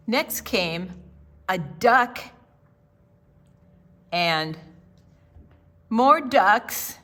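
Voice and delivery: plain reading voice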